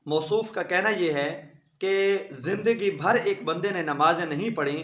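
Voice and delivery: usual speaking voice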